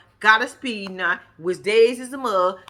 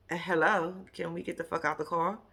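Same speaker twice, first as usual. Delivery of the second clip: deadpan impatience; 5 semitones lower, 9 dB quieter than usual. Retold or imitated. Reacts to couple's kissing.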